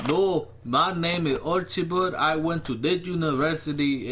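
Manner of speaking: put-on Archibald, African accent. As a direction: imitate an indignant man's African accent on the phone.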